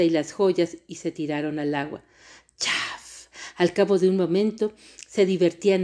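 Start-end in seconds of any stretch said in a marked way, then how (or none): 2.6-3.3 soft, voiceless "chaf"; splash noise. A narrator's reading. Narrator imitating girls hitting the water, soft splash.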